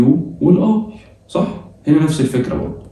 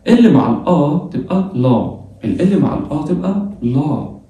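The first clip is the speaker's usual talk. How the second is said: slow teaching read; slower than usual. Shown as a demonstration. Teacher reading a rule slowly to students: slow and clear. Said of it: "تعالى نقرأها مع بعض".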